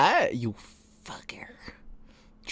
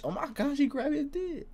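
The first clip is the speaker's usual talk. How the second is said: very high, hushed disbelief; high, small scandalized voice; scandalized gasp; 6 dB quieter than usual. Real, not acted. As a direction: squeak it softly in mock horror. Scandalized reaction to the scene.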